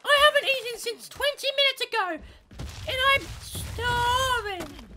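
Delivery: put-on very high, loud child's whine; whining sisters. Sisters to Mum before dinner, exaggerated high whining. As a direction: wail it like a hungry kid, drawing out the end. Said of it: "what my sisters say they're starving at".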